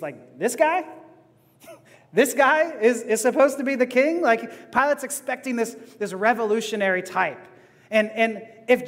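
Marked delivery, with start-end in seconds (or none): 0.4-4.2 scoffing Pilate. Retold or imitated. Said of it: "That's how Pilate thinks of Jesus".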